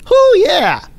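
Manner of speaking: high whooping cheer; self cheer. Retold or imitated. Trader cheering his first trade, high excited whoop. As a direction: whoop like the money is already made.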